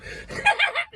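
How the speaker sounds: big high burst of laughter; loud high laugh. Real, not acted. Laughing at his buddy's blunt joke.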